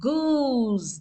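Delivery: "Goose" with the oo drawn out; long, held vowel in "Goose"; dragged long vowel. Shown as a demonstration. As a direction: drag the oo in "Goose". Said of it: "थोड़ा हम drag कर रहे हैं".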